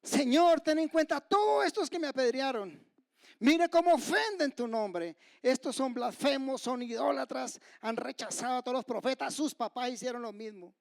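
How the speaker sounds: high, loud indignant prayer, gradually easing; crying out loudly. Pretend. Preacher imagines Stephen denouncing his stoners to God, loud cry. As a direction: cry accusations to heaven, hammed-up. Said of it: "clamó a gran voz".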